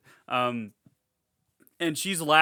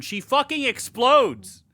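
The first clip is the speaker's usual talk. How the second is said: loud, high and drawn-out; gleeful excitement; 9 semitones higher, 7 dB louder, much slower than usual. Real, not acted. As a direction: hammer each word with gory delight. Relishing the film's shock moment.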